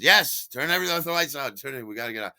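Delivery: high, loud, hurried orders; urgent agreement. Retold or imitated. Steven answering about closing up, urgent and hurried. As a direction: fire off the orders to get out fast.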